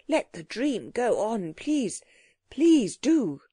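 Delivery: drawn-out, imploring plea that climbs high; fervent pleading. A narrator's reading. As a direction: beg for it to continue, rising in urgency. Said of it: "he pleaded fervently".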